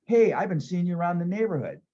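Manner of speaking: imagine the kind answer; imagined friendly reply. Pretend. Imagining the homeowner greeting him warmly, hopeful.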